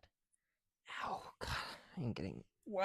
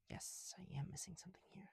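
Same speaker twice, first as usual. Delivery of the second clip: whisper; 8 semitones higher, 12 dB quieter than usual. Real, not acted. Answers self, repeats line.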